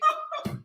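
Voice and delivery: short high laugh; quick, high-pitched laugh of disbelief; incredulous laugh. Real, not acted. Laughs at what she read.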